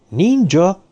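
high, rising startled question; baffled surprise; taken aback. A narrator's reading. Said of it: "Hökkent meg Karahan".

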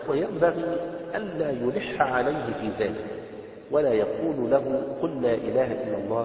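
slow, loud, measured recitation of the book; formal text reading. Acted. Teacher reading the book text aloud to the class, slow formal recitation.

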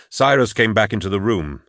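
usual speaking voice

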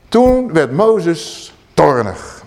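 very slow, weighty delivery; preacher lingers on Moses' anger, very slow; dramatic slow narration. A narrator's reading. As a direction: drag out the words for effect.

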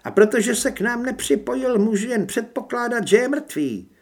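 high, loud villainess voice; hostile werewolf woman. A narrator's reading. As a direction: shout across as an accusing woman.

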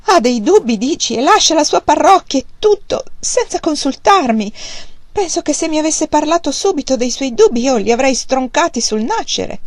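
a hurt mother venting her grievance loudly; agitated mother. A narrator's reading. Mother to daughter about the husband's doubts, aggrieved and loud.

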